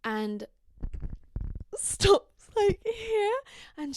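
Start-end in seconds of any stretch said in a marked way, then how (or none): none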